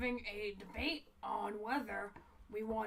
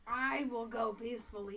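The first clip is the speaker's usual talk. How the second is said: ordinary talk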